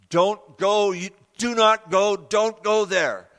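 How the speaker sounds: loud, high, heavy warning, slowing down on the last line; oppressive inner voice. Retold or imitated. Preacher voicing the dark voice in his head, a loud slow warning. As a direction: bear down on him like a crushing weight. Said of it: "with that voice, there was a, a heaviness".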